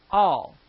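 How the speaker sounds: "All" as a firm one-word correction; emphatic all. Shown as a demonstration. Preacher correcting the misquoted verse, emphatic "All". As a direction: land "All" hard, standing alone. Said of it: "I really want to emphasize that".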